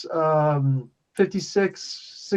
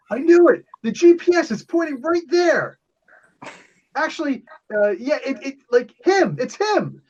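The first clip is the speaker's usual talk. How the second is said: much higher, agitated character voice; hammed-up high-pitched goon; excited henchman; 10 semitones higher than usual. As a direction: cry out high as an excited bad guy. Game master voices excited enemy.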